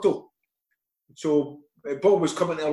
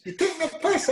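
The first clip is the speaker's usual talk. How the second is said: incredulous high-pitched retort; 12 semitones higher than usual. Retold or imitated. Telling the caller he must be taking the piss, in high-pitched disbelief. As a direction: voice jumps much higher in disbelief. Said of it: "I says".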